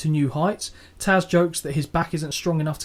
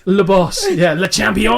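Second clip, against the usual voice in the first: hammed-up French, loud and high; mock French accent; 4 semitones higher, 8 dB louder than usual. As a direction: announce it like a French champion. Joking French title play.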